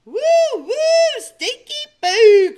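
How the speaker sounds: high, loud jeering cry; loud mocking sing-song; disgusted cry. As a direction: shout the name-calling. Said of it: "cried Jackrabbit".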